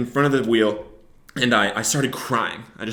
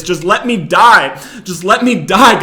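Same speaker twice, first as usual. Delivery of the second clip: higher, louder, slow desperate yell; yelling at God; 7 semitones higher, 8 dB louder, slower than usual. Retold or imitated. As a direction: shout the plea at God. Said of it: "I started yelling at him".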